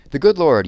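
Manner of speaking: good Lord said loud and high; emphatic. A narrator's reading. Mrs Tennyson correcting Sam, emphatic. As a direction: lean hard on the good Lord.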